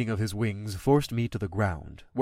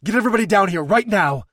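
louder, faster, higher command; urgent order; 7 semitones higher, 6 dB louder, faster than usual. Acted. Rider ordering her dragon, urgent and loud. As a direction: bark it out in a hurry.

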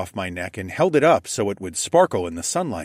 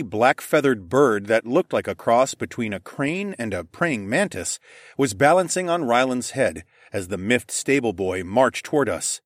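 ordinary delivery

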